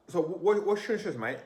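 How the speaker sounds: put-on high, loud London accent; British accent. Imitating how he talked in the UK, in a British accent. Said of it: "I was speaking like this in the accent".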